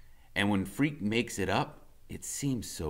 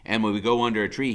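ordinary delivery

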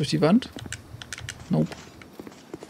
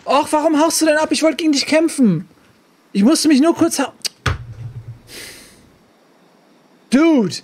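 indignant yelling; 7 semitones higher, 3 dB louder than usual. Real, not acted. Yelling at fleeing enemy.

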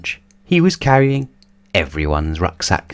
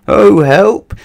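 high, loud wail for help; panicked shriek; 12 semitones higher, 7 dB louder than usual. A narrator's reading. Mister Jelly as the rain starts, panicked shriek. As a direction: shriek it in alarm. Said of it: "shrieked Mister Jelly".

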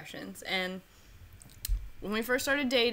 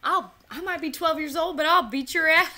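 mock yell; 5 semitones higher, 5 dB louder than usual. Continuing the movie quote.

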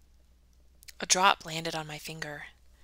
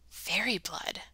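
hushed, wondering question; awed whisper; 5 dB quieter, slower than usual. Acted. Ella learning she has fairy blood, hushed awe. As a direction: breathe it in amazement.

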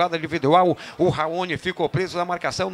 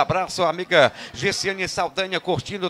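ordinary talk, nothing marked

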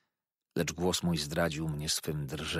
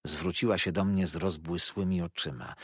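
ordinary delivery

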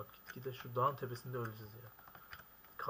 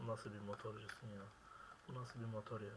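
ordinary delivery

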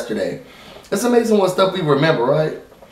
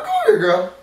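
high mock call. Mock grandma scene.

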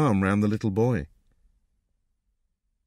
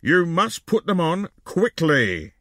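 higher, drawn-out cross order; impatient raised voice; cross shout; 6 semitones higher than usual. A narrator's reading. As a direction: order them crossly, stressing each word. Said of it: "shouted the man crossly".

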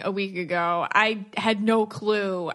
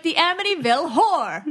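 mimicking Long Island accent; 6 semitones higher, 3 dB louder than usual. Wife imitating husband's Long Island horror, in a Long Island accent. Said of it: "You're like".

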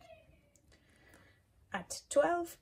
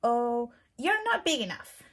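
grouchy ladybug voice; 3 semitones higher, 8 dB louder than usual. Acted. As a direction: pick a fight in a squeaky bug voice. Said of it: "said the grouchy ladybug".

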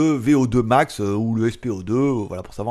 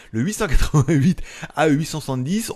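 normal talking voice

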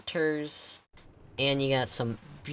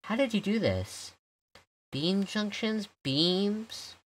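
curious awe; 8 semitones higher, slower than usual. Real, not acted. Puzzling over a clever trick.

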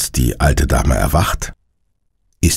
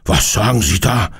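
higher-pitched, mildly alarmed question; startled; 5 semitones higher than usual. A narrator's reading. Man in pyjamas hearing of a break-in, startled. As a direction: react with a touch of fright. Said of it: "fragt erschrocken".

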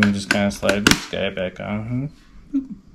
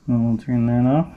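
muffled behind helmet visor; speaking inside a helmet. Real, not acted. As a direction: voice through full face helmet. Seen 1: Helmet covers whole face while speaking; removed around +8.5s.

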